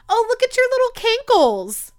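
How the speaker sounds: high, sing-song mock of the nurse. Nurse quoted mocking her ankles.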